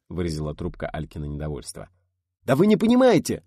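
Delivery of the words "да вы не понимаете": much higher, loud angry outburst; explodes. A narrator's reading. The historian, fed up with the women, explodes loudly. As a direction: blow up at them in frustration. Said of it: "взорвался кандидат".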